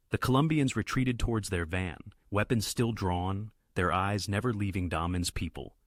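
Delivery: usual speaking voice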